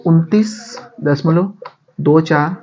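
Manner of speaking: drawn out and slow; slow down to dictate; slow dictation. Shown as a demonstration. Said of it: "dictating a sum step by step".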